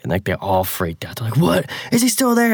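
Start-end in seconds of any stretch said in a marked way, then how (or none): 1.4-2.5 high, excited squeal of what; excited cousins. Retold or imitated. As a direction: shoot up high, thrilled. Cousins freaking out.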